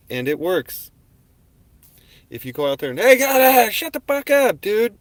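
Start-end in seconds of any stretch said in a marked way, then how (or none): 3.0-5.0 much louder, much higher angry yell; yelling at dogs. Retold or imitated. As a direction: bark it at the dogs in full anger. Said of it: "when you start yelling at 'em like that".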